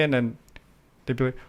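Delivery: ordinary delivery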